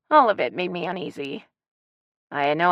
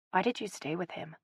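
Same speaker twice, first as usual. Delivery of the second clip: much softer, hushed question to mom; asked softly; 12 dB quieter than usual. A narrator's reading. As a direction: ask gently, almost under the breath. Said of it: "I asked softly".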